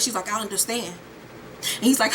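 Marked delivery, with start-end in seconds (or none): 0.3-0.9 quiet understanding. Retold or imitated. Acted grave, quiet reply.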